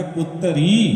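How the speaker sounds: ਪੁੱਤਰੀ split as put-ta-ree with a long final ee; ਪੁੱਤਰੀ in three syllables, last one held; pronunciation demo. Shown as a demonstration. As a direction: stretch the ending of ਪੁੱਤਰੀ after a clear short a. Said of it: "ਇਹਨੂੰ ਆਪਾਂ ਉਚਾਰਨ ਕਰਾਂਗੇ".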